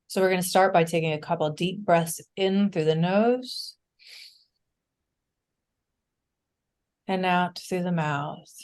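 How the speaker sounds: unhurried breath cues with long pauses; calm meditation leader, increasingly hushed; guided meditation. Real, not acted. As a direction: guide the group gently through each breath, softening as it settles.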